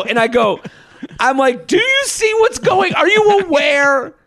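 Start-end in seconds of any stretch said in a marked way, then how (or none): 1.7-4.1 high, incredulous call-out; exasperated shout. Retold or imitated. Retelling calling out the rude guy, high exasperated shout.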